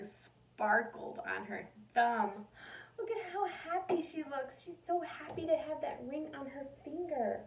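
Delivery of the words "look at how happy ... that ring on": high, bright pointing-out; delighted. Real, not acted. Teacher showing children the picture, high and delighted.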